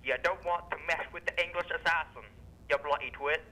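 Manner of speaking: low, menacing mock Australian accent; Australian-sounding English accent. English assassin threatening a guest, fake Australian accent. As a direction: warn them in a bad English-Australian twang. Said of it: "an English assassin talking with an Australian accent".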